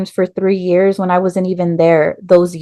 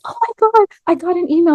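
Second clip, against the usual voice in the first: much higher, breathless oh my God; panicked excitement; 11 semitones higher, faster than usual. Retold or imitated. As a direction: squeal it out, nervous and thrilled. Panicked on seeing admission email.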